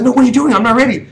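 imitating a panicked friend, loud and high; panicking. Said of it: "He started panicking".

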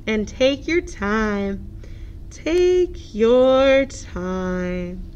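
very slow, drawn-out turtle. Acted. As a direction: stretch every word like a turtle.

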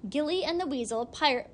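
usual speaking voice

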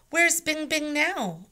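high, loud, slow eager asking; can't wait to see her; eager question. A narrator's reading. Annie eagerly asks for the panda.

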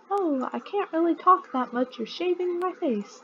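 high-pitched put-on customer voice; customer being shaved. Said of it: "That's how he sounds".

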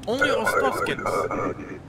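slow, high, loud proclamation; booming call. Pretend. Border guard announcing who may enter, booming call.

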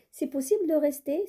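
C'est possible de rester, rising on possible and up at the end like a question; question tune. Shown as a demonstration. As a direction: ask it, pitch climbing high. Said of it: "c'est une question".

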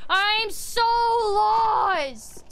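high, drawn-out cry of despair; exasperated, very slow high wail; frustrated wail. Real, not acted. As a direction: wail it out, stretching each word. Lost in the game, wailing.

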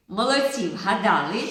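ordinary talk, nothing marked

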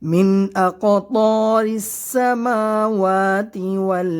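slow, melodic tajweed chant with long held vowels; Quran recitation. Acted. Teacher reciting the verse to his students in a slow melodic chant. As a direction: chant each word out slowly like a qari.

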